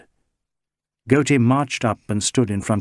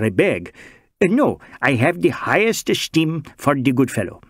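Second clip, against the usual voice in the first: Bengali social worker; 3 semitones higher than usual. Acted. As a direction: play the mild Bengali gentleman pleading his innocence. Chatterjee voiced by narrator.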